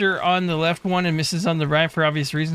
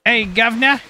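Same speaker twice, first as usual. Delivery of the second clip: hammed-up British accent, higher and louder; 7 semitones higher, 5 dB louder than usual. Shown as a demonstration. Streamer warming up for a requested line, mock British accent. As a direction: put on a loud, high-pitched British accent. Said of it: "in the best British accent".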